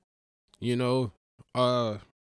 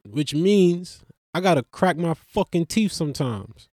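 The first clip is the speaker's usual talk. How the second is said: ordinary delivery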